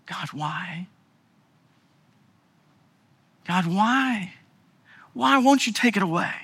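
anguished why. Acted. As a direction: press God, hurting. Acted anguished prayer.

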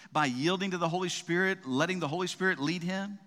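ordinary talk

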